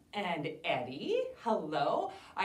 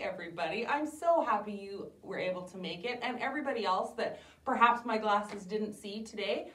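ordinary talk, nothing marked

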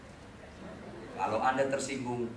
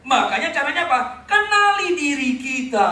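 preaching shout; 10 semitones higher, 9 dB louder than usual. Acted. Preacher calls out to congregation.

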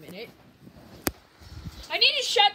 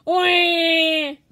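loud high cartoon villain call; Waluigi voice shout; 4 semitones higher, 11 dB louder than usual. Vlogger ends his Waluigi bit with a loud high shout. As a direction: bellow a goofy villain greeting. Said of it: "That's my voice for Waluigi".

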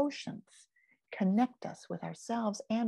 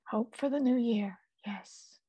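whispered wish. Real, not acted. Soft wish for the new year.

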